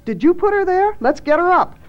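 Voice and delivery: high, urgent accusation and order; demanding. A narrator's reading. Janice confronts Thad and takes charge, demanding. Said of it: "demanded Janice".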